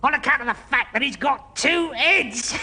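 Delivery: usual speaking voice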